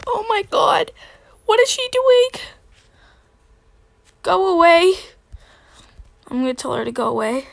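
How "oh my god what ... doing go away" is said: high loud frightened yell; scared shriek. Real, not acted. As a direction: shriek at them to leave. Yelling at creepy player.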